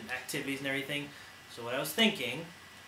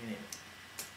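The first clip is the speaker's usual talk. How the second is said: breathy whisper, much softer; whispered aside; 6 semitones lower, 15 dB quieter, slower than usual. Real, not acted. Repeats the phrase as an aside.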